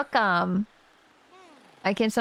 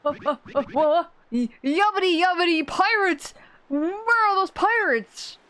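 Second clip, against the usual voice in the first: mock-alarmed old sailor, slowing on the last question; startled old sailor; 9 semitones higher than usual. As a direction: sputter awake looking for pirates.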